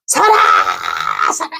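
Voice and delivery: long mock yell to halt; retold shouting. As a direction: imitate a guard calling out from afar. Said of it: "이러고 소리 지르고".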